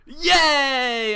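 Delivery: high, loud drawn-out cheer; long "yay" falling from high; celebrating. Real, not acted. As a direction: cheer a win. Celebrating a won bet.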